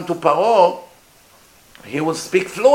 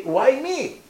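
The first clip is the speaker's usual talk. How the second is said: stutterer's excuse; 7 semitones higher than usual. Acted. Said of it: "you would say".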